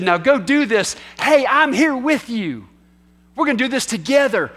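rally them as their leader at your side; rallying encouragement. Acted. Preacher as Jesus sending disciples out, rallying and encouraging.

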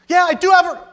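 high, loud defiant cry; cut-off angry yell at God; angry shout. Acted. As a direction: blurt it out in defiance. Jonah defying God again.

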